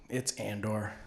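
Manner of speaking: quiet correction. Retold or imitated. People correcting his mistake.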